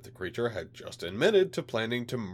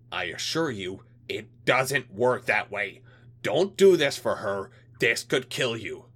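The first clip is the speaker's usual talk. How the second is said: cold threatening official voice, louder; a hint of a menacing alien; 2 semitones higher, 5 dB louder than usual. Alien liaison warns.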